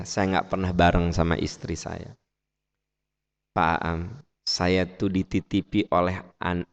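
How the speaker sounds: subdued, low imitation of a sad husband; retold tearful confession. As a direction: lightly voice a man confiding his burden. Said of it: "di Arafah suaminya nangis". (curhat suami yang sedih, lirih)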